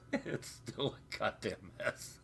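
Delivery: slow words squeezed through real laughter; laughing. Real, not acted. Streamer admitting to viewers it's still a mess, laughing.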